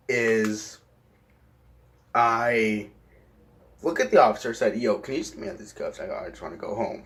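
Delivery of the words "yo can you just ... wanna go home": soft, fast plea under the breath; quiet pleading. Retold or imitated. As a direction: mumble it as if not really said aloud. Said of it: "I didn't actually say it out loud".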